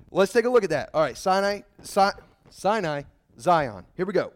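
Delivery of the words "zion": usual speaking voice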